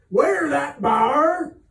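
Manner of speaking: loud, slow, high bellow; bellowed order. A narrator's reading. Captain bellowing at sailors over a trivial matter, loud and slow. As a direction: drag the order out across the deck. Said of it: "bellowed like a madman".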